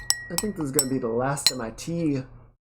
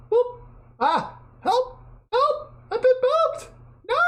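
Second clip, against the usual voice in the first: high, loud squealing cries for help; hammed-up shrieks like a cartoon victim; playful fake distress, very high and loud; mock panic; 6 dB louder than usual. Mock panic at being booped.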